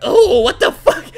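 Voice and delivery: high, loud startled yelp; jump scare. Real, not acted. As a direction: yelp as if something just jumped out at you. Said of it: "That was like a jump scare".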